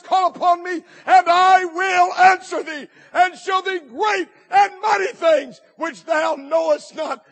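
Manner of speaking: loud, slow preached verse; preacher thundering the scripture; shouted scripture. Acted. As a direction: proclaim the verse with full force. Preacher quotes Jeremiah.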